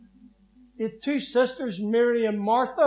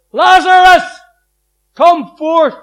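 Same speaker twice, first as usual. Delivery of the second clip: loud, ringing call into the tomb; booming summons, higher and much louder; commanding call; 8 semitones higher, 20 dB louder, slower than usual. Retold or imitated. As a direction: call him out of the grave at full voice. Jesus calls Lazarus from tomb.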